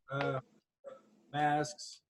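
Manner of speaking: normal talking voice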